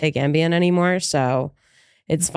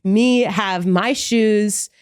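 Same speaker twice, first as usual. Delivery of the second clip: slow pleading; much slower than usual. Real, not acted. Pleading slowly about slippers.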